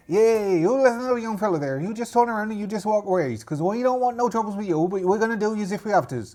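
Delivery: pirate voice, warning them off; threatening rival pirate. Acted. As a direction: threaten intruders in a put-on pirate accent. Rival pirate warns the party.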